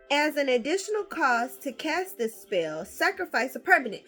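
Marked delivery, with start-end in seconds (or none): none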